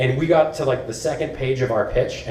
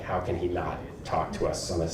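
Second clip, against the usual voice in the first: much softer and lower, under the breath; muttered aside; 6 semitones lower, 10 dB quieter than usual. Retold or imitated. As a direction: grumble it to yourself so nobody hears. Muttering their thought at the ceremony.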